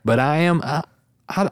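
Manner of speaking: usual speaking voice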